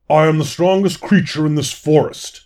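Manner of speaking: loud boasting fawn lord; booming, proud proclamation. A narrator's reading. Fawn lord boasts to all the gathered animals, booming and proud. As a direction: boom it out over a crowd, proudly. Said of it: "His voice boomed over the crowd".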